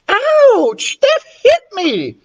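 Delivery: high, whiny yelp of pain; drawn-out ouch, shrill indignant complaint; pained shout. A narrator's reading. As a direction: cry out like a hurt crybaby. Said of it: "shouted this girl named Annette".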